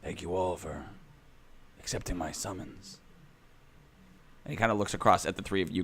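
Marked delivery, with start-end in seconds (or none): 0.0-3.0 softly spoken, solemn welcome; a hushed, measured address to those summoned; quiet monolith. Acted. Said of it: "he says really quietly".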